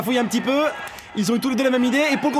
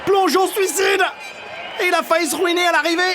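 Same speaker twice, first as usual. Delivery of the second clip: excited shout; 7 semitones higher than usual. Real, not acted. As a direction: yell it like a big dive just happened. Commentators calling a dive.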